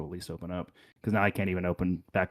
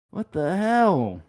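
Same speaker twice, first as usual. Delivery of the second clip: exasperated; 12 semitones higher, 7 dB louder, much slower than usual. Real, not acted. Exasperated at broken internet.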